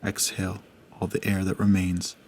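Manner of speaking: hushed, unhurried breathing guide; guided meditation. Acted. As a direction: gently guide the listener through each breath.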